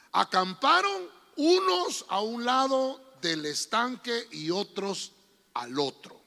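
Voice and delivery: slow Bible reading; scripture reading. Acted. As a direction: read the passage deliberately. Reads scripture slowly. (lectura bíblica pausada)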